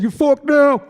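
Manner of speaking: high, loud mock-tough yell; shouting down at the lady in the lake; punchline shout. Punchline, throwing her in lake.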